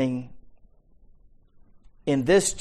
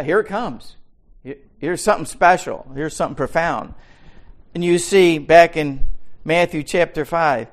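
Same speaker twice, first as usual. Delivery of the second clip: louder, higher pulpit voice building emphasis; rising preaching; 5 semitones higher, 8 dB louder than usual. Acted. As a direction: preach it, announcing something special.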